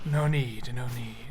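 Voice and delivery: soft, conspiratorial murmur; low voice. Acted. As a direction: lean in and keep it secret. Patron keeps hushed voice.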